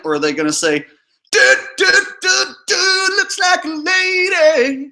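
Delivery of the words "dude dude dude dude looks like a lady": high, slow mock dude voice, far above his own; mocking bystander voice. To his viewers, mimicking strangers judging the cross-dresser, high, slow and mocking. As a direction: ham up a gawking guy spotting a lady. Mocking what people would say.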